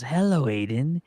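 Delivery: soft imitation of a creature voice; Gollum. Retelling actor greeting his son, lightly imitated Gollum voice. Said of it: "he starts talking in his Gollum voice".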